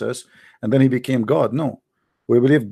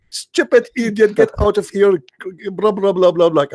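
mocking angry chatters; 6 semitones higher, 3 dB louder than usual. Chatters kicking him out, mocked.

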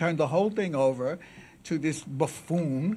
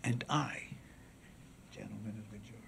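classical oration; 3 semitones lower, 7 dB quieter than usual. Acted. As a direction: address the jury gravely with long pauses. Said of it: "was classical oration".

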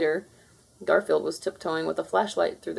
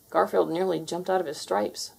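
ordinary delivery

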